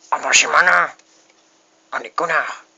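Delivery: loud angry outburst; angry shout. Real, not acted. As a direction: yell it at the traitors. Shouting at fleeing players.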